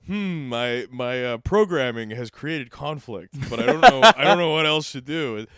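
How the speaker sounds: mock android confused by its own programming; robot voice. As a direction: play a glitching machine. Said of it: "And you go".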